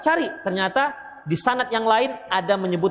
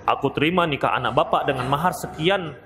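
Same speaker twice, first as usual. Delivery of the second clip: one-breath vow; 7 semitones lower than usual. Shown as a demonstration. Groom showing the vow must be said in one breath, lower and unbroken. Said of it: "Harus satu napas".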